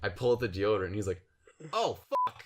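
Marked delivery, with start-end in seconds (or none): 1.7-2.4 high-pitched mock-shocked exclamation; retold shock. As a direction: act out his stunned reaction. Retold shocked reaction at bite.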